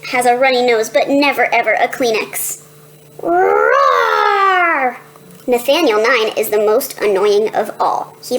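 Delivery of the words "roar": lion roar. Acted. Pretends to be lion.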